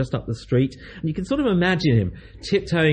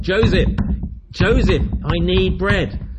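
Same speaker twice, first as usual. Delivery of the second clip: loud, higher pleading call, the last words slow; insistent calling; 6 semitones higher, 4 dB louder, slower than usual. Retold or imitated. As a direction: keep banging and call him louder. Persistent knocking, calling friend.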